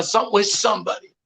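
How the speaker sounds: normal talking voice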